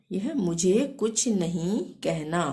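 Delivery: normal talking voice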